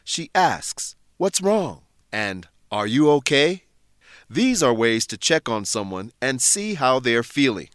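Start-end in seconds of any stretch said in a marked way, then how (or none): none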